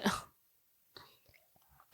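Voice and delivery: sighing the words out; breathy whisper. Real, not acted. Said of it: "psh, sighs".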